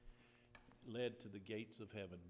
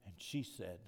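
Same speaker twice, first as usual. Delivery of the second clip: ordinary talk, nothing marked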